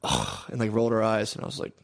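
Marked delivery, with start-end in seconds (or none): 0.0-0.4 low, softer scoff of dismay; imitated disgusted groan. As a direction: groan low like an annoyed onlooker. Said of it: "she saw me and went".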